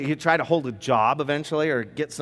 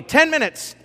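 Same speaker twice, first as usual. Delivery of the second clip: dad calling out; 12 semitones higher, 6 dB louder than usual. Acted. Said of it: "sounds maybe a little bit like this".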